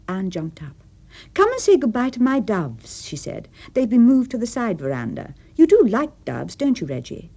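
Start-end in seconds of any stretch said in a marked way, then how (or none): 1.3-7.3 eager. Acted. Anne eager, inviting.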